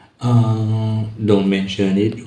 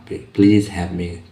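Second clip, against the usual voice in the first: very slow, word by word; slow classroom demo; slow model phrase; 3 dB louder, much slower than usual. Shown as a demonstration. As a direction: spell it out slowly for learners. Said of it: "Tambah kata please di awalnya itu".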